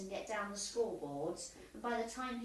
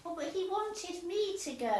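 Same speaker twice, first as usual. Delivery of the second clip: protesting; 7 semitones higher, 4 dB louder than usual. Acted. Competing to go first.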